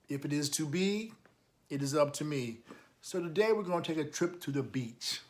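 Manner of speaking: ordinary delivery, nothing marked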